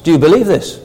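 ordinary talk

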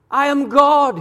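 high, loud proclamation in God's words; God declaring. Retold or imitated. Preacher voicing God as the answer to the dilemma, loud and high. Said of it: "he says".

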